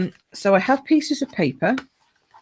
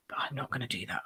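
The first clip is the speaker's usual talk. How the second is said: whispered aside; 5 semitones lower, 14 dB quieter, faster than usual. Real, not acted. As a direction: drop to a secret whisper.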